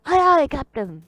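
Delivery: high, loud acknowledgement; shouted reply. Acted. Crewman answers the captain's order, shouting. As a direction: call back smartly over the din.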